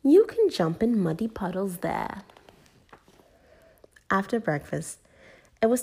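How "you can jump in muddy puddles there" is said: ordinary delivery, nothing marked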